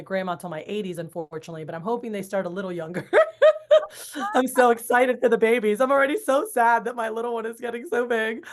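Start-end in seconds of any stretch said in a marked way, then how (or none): 3.1-4.3 a sudden bright burst of laughter, high and loud. Real, not acted. Host laughs at own joke. 4.3-8.5 higher and louder, words carried on a laugh; laughing excitedly. Real, not acted. Gushing about her kids.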